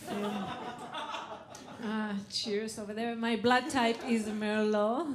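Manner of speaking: ordinary delivery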